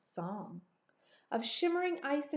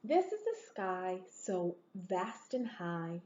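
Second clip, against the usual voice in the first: ordinary delivery, nothing marked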